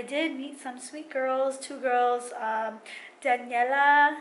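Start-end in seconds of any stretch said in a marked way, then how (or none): 3.2-4.2 Daniela in a Spanish accent. Real, not acted. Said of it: "where did the Spanish accent come from".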